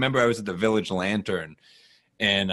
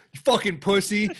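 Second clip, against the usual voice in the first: higher, hammed-up replay of the insult; mock retort; 10 semitones higher than usual. Comic mocking his own heckler comeback, high and hammed-up. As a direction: toss the insult up high as a joke. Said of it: "I didn't say".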